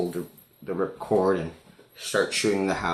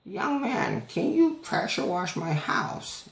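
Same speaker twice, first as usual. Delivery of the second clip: put-on high, slow old lady voice; high-pitched old woman impression; 13 semitones higher, slower than usual. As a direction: imitate a frail old lady asking politely.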